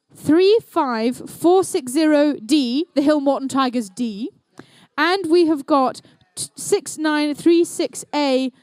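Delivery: normal talking voice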